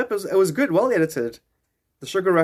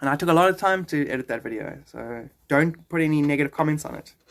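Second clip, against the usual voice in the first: ordinary delivery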